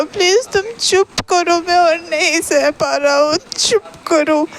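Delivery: high, desperate tearful outcry; crying screams. A narrator's reading. Ibo desperately tells Shahjahan he won't hit him, crying out. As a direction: cry out in anguish without a full shout. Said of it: "बहुत ज़ोर-ज़ोर से रोता और चीखे कहता".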